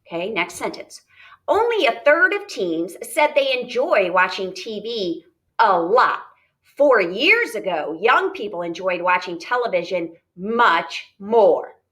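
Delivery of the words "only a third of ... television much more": measured classroom read-aloud. Shown as a demonstration. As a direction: read each word deliberately to the class. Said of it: "here's my reading voice".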